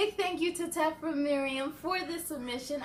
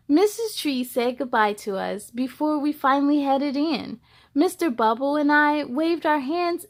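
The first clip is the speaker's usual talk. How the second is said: lively, louder storybook reading voice; story narration; 9 dB louder than usual. A narrator's reading. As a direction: read it like bedtime storytime for kids. Reads picture book aloud.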